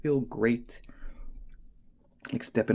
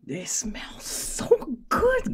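drawn-out, higher savoring; slow dreamy delight; 13 semitones higher, much slower than usual. Real, not acted. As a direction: savor the smell. Sniffing strap, delighted.

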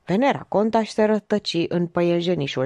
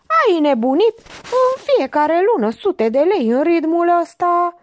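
high, loud, outraged shouting; choked yell; 11 semitones higher, 5 dB louder than usual. A narrator's reading. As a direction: yell it as if choking with indignation. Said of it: "Țipă sufocat". (țipăt sufocat, indignat)

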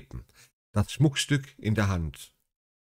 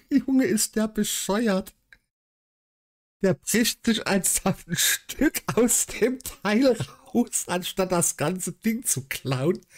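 höher und langsam, kopfschüttelnd ungläubig; fassungslos amüsiert; 10 semitones higher, slower than usual. Real, not acted. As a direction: staunt über so viel Dummheit, langsam. Fassungslos über den Dieb. (amüsierte Fassungslosigkeit, höher und gedehnt)

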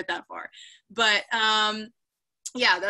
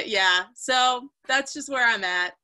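ordinary talk

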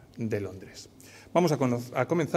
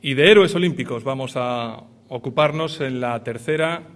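loud, lifted gala host voice; booming prize announcer; 5 dB louder than usual. Acted. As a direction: announce it to the whole theatre. Gala host opens final part.

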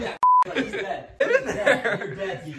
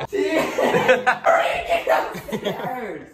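urgent call broken by laughter; cracking up while hurrying someone; laughing urgency; 3 semitones higher, much slower than usual. Real, not acted. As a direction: call out through laughter, stretching the end. Urging Tegan while cracking up.

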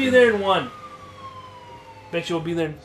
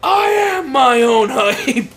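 boastful shout; 7 semitones higher, 7 dB louder, slower than usual. Acted.

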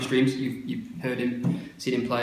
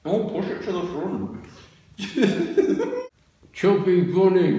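cheeks stuffed full; 6 semitones higher, 4 dB louder than usual. Real, not acted. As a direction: mouth full. Marshmallows stuffed in mouth.

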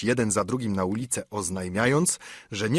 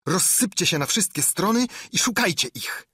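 barking orders; 9 semitones higher than usual. A narrator's reading. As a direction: hammer out the orders. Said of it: "powtarzał Piu, waląc kosturem". (ślepiec powtarza rozkaz, wściekle)

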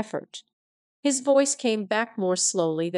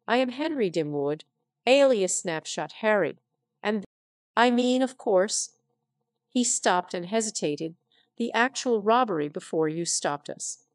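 ordinary delivery

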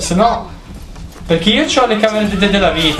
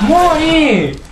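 high, drawn-out battle yell; attacking yell; 11 semitones higher than usual. Real, not acted. Player attacking an enemy in the game, yelling.

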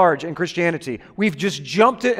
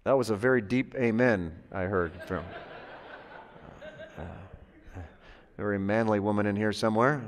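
much lower and softer, mock manly rumble; deep manly voice; 11 semitones lower, 12 dB quieter than usual. Teasing the congregation in a deep manly voice. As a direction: drop way down like a burly man.

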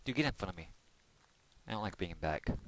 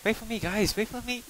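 high, pleading cry; panicked plea; 11 semitones higher than usual. Real, not acted. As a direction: beg them to wait, scared. Left behind while chased.

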